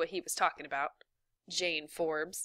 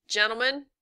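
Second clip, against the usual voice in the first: put-on raised, higher call to the boys; 5 semitones higher, 6 dB louder than usual. A narrator's reading. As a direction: call after them to stop. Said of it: "he called".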